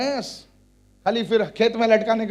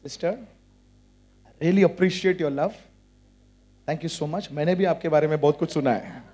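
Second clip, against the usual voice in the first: low, soft mock-courteous thanks; polite reply; 4 semitones lower, 3 dB quieter, much faster than usual. Believer answering a gossiping sister, low polite voice. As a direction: answer the gossip gently and quietly. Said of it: "Look at that sister and say".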